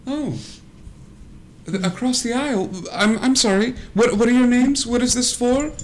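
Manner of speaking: high, flustered Barbie voice. Acted.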